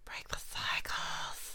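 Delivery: slow soft whisper. Pretend. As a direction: whisper it slowly. Co-host whispers catchphrase.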